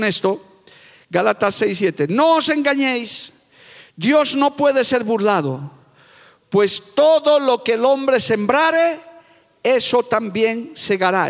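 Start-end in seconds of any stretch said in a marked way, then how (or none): 2.1-11.3 slow, raised preaching cadence, each phrase weighted; solemn scripture reading. Acted. Preacher reading Galatians to the congregation, slow and solemn. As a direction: read the verse to the congregation like holy law.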